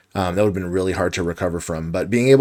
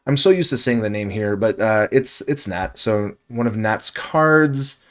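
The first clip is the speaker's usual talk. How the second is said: ordinary delivery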